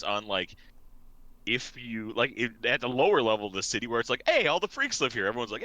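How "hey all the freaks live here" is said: mock cheery welcome. Voices low-level city crowd greeting freaks.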